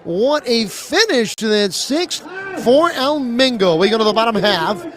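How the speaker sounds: loud, high play-by-play roar to end the inning; excited strikeout call. Real, not acted. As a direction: sell the big strikeout, full energy. Big strikeout ends the inning.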